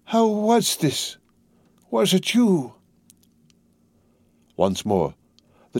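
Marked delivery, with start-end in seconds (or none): 0.0-2.7 put-on much higher, drawn-out old man's question; each word forced out slowly; slow, halting. A narrator's reading. Prisoner slowly asking his daughter, high and halting. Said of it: "they came to him coherently, though slowly".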